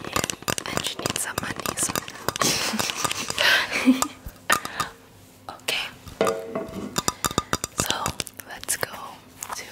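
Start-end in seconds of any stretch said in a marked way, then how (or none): 2.0-4.9 whispered giggle. Real, not acted. Laughs mid-whisper.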